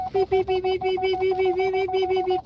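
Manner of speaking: high, steady, monotone beeping; long run of high mock beeps; imitated backup beeper. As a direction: mimic a reversing truck's beeper. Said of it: "the backup beeper is gonna start beeping".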